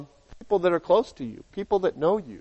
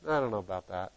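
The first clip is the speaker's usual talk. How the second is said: much softer, doubtful mumble; dismissive friend; 4 semitones lower, 7 dB quieter, faster than usual. Acted. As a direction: trail off unconvinced. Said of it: "when you talk to your other friend".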